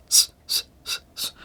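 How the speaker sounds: sharp repeated s hisses; hissing s. Shown as a demonstration. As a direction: hiss the s like a reverb tail. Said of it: "all you're gonna hear in the reverb and the delay is s".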